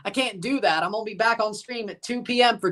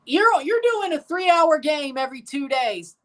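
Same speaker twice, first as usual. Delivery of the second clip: exasperated retort; 8 semitones higher than usual. Retold or imitated. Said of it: "I'm like".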